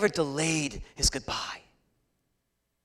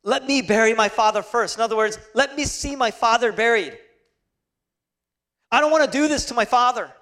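raised, louder pulpit voice; urgent sermon delivery; impassioned preaching; 8 semitones higher, 8 dB louder than usual. Acted. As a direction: preach it forcefully, pitch lifted high. Preacher at full force.

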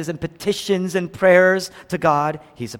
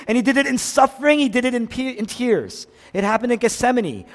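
normal talking voice

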